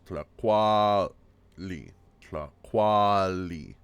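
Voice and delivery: TLACUALI slowed, tla-KWAAA-li; stress on A. Shown as a demonstration. Teacher shows where the stress falls, stretched demo. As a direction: hold the A of TLACUALI long, then drop. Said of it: "It's on the A there".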